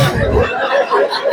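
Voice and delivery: high, breathless laugh; laughing at the memory; real airy laugh. Real, not acted. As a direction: break into laughter. Grandmother flipping him off.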